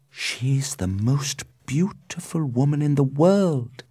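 high-pitched lovestruck awe; exclaiming in wonder. A narrator's reading. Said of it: "he exclaimed".

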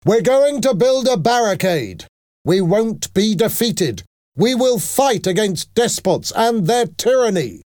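high-pitched, ringing defiance; rallying shout. A narrator's reading. Young leader urging resistance against tyranny, shouting. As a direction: proclaim it to the crowd, pitch held high. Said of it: "he shouted".

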